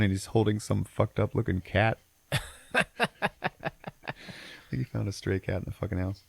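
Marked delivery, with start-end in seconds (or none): none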